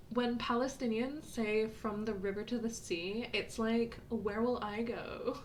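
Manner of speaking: mock a rich Brooklyn Valley Girl. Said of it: "Valley Girl voice".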